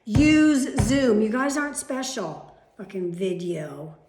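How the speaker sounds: loud, forceful venting; real angry rant. Real, not acted. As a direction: blast it out in real anger. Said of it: "You don't know how mad I am".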